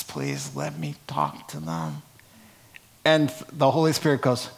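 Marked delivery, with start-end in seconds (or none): 0.0-2.0 much lower, much softer plea; Paul softly asking to address the crowd, quiet plea. Retold or imitated. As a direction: plead quietly, almost spent. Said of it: "he stands up and he says".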